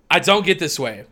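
normal talking voice

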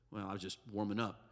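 [name] baritone. Retold or imitated. Young singer answering his mother in a deep low voice. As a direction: drop into a low baritone murmur. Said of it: "sing in baritone voice".

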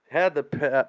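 normal talking voice

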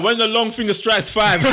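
slow, high-pitched parental warning; drawn-out imitation of a strict mother; mock ultimatum. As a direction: lay down the deadline like a mum. Mum's ultimatum acted out, laughing.

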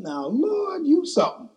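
high, awed exclamation to the Lord; amazed. Retold or imitated. Preacher marvelling at the confirming scripture, high awed voice. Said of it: "I said".

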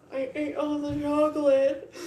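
high, loud, slow sobbing wail; blubbering about the chocolate, crying high and loud. Retold or imitated. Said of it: "and I'm just crying".

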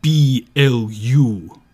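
slow, loud spelled letters. Acted. Spelling band name dramatically.

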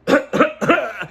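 a loud, high, exaggerated cough; demonstrated cough. Shown as a demonstration. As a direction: hack it out like you're showing how it's done. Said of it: "Tem que tossir desse jeito".

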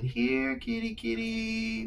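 high, slow sing-song kitty call; calling the cat. Retold or imitated. Girl searching the rooms for her cat, sing-song call. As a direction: coax a cat out, lilting up high. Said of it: "As you're walking around saying".

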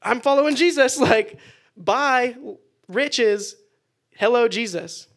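cheerfully dump the money and run to Jesus; gleeful farewell. Retold or imitated. Fishermen leaving their catch, gleeful and laughing. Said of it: "and they go".

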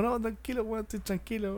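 calm her down gently; quiet mock plea to stay calm; soft reassuring. Spider-Man calming grandma down.

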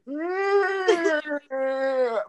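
long high drawn-out imitated yawn; mimicking people who yawn too enthusiastically; mock yawn. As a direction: overdo a huge stretching yawn. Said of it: "and they're like".

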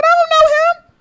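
high, loud innocent denial; high loud pretend denial; mock denial. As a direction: insist you don't know him, way up high. Repeated denial, louder.

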